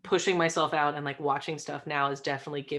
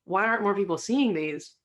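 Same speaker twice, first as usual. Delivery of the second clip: higher-pitched, amazed outburst; blown away; 4 semitones higher, 3 dB louder than usual. Retold or imitated. Guest recalling the first indie film they watched, blown away. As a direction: retell being astonished by a discovery. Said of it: "blown away".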